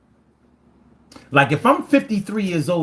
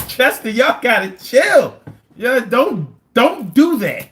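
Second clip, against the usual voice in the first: high, loud, drawn-out telling-off; mock scolding; 8 semitones higher, 5 dB louder, slower than usual. Telling Chester to calm down, in loud mock scolding. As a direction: playfully order them to back off, pitched up.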